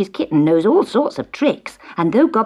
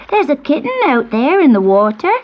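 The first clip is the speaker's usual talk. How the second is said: put-on excited children; 7 semitones higher, 4 dB louder than usual. A narrator's reading. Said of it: "they shouted".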